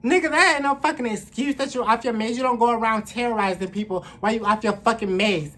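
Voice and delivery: high, loud scolding; outraged rant. Real, not acted. Woman scolding the man who banged on her door, furious. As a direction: tear into him with angry contempt.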